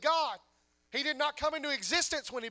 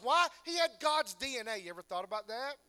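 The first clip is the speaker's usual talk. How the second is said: usual speaking voice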